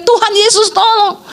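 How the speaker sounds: mild imitated yell; retold cry for help. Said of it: "Makanya dia teriak".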